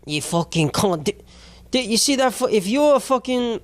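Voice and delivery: wobbly mock Australian, almost Cockney; bad Australian accent. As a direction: put on a hammed-up drunk Aussie accent. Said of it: "I have to be an Australian accent".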